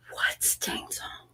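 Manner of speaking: slow voiceless whisper; baffled hushed whisper. Pretend. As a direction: breathe the question out. Reading chat question in whisper.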